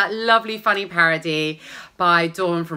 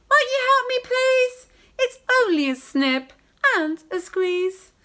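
pleading woman. Acted.

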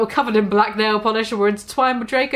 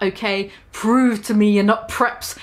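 plain reading voice

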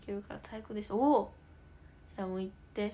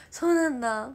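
louder, bright surprise; surprised; 2 semitones higher, 12 dB louder than usual. Real, not acted. Streamer surprised by a viewer's comment. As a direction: react with a loud oh really.

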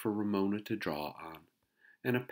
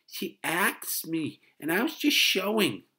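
put-on high, loud, drawn-out little-girl whine; protesting child; 13 semitones higher, 10 dB louder than usual. A narrator's reading. Little girl explains herself to mother, protesting. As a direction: object in an aggrieved high child's voice. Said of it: "protested Ramona".

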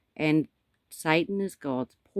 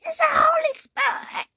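cracking up into a much higher, crying shout; voice breaking; 5 dB louder than usual. Pretend. Preacher copying a man whose voice broke, cracking high. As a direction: imitate a voice breaking on "Holy Spirit". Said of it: "I'm not a young man whose voice is breaking".

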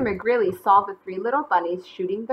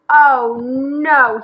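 loud, higher cry of dismay; angry exclamation; 4 semitones higher, 10 dB louder than usual. A narrator's reading. McGreely spotting bunnies on his blanket, angry exclamation. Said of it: "he exclaimed".

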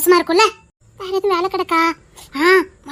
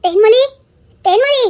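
calling out; 4 semitones higher, 4 dB louder than usual. Acted. Calling Thenmozhi again.

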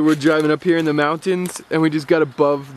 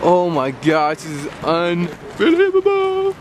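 amazed; 2 semitones higher than usual. Real, not acted. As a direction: gasp it out in wonder. First view above the clouds.

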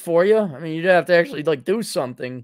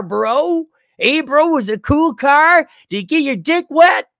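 much higher, louder dumb-bro impression; mock bro voice; 10 semitones higher, 4 dB louder than usual. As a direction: play a dopey party bro, hammed up. Mocking the caller as a bro.

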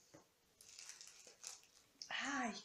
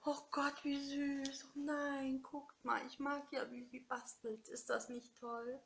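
gushing delight; 7 semitones higher, slower than usual. Real, not acted. Gushing over the handmade card.

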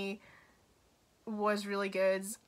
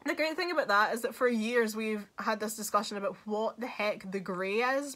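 ordinary talk

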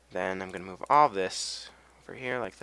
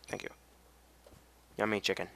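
normal talking voice

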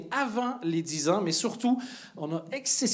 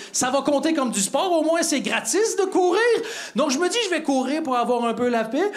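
mock exasperated rant, high-pitched and climbing; brother-in-law getting worked up; 10 semitones higher than usual. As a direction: build from irritation to comic outrage. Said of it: "pas de raison de s'énerver".

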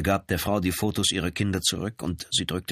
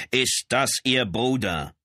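slow, raised voice short of shouting; toned-down insistent question; 5 semitones higher, slower than usual. A narrator's reading. Lieutenant repeats his question to the brother, slower, toned down. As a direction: repeat it slowly, higher, holding back the roar. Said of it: "wiederholte Brown seine Frage etwas leiser".

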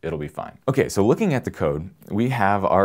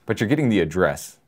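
usual speaking voice

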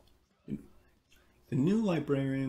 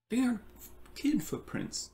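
put-on child voice; 11 semitones higher than usual. A narrator's reading. Alex voiced in storybook.

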